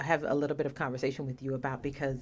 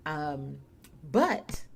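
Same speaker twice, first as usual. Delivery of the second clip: normal talking voice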